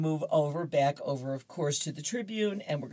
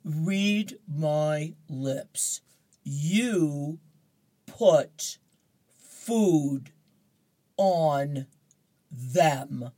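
very slow, word by word, getting louder; slow Garfield; 5 dB louder, much slower than usual. Acted. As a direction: spell it out like talking to a dim owner. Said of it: "Garfield says".

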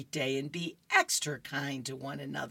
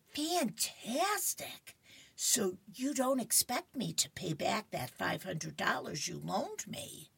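higher voice with a stretched first word; hammed-up delight, easing into a softer question; delighted comic friend; 5 semitones higher, 4 dB quieter than usual. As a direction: play a pleased cartoon friend, pitched up. Voicing Opal's delighted friend.

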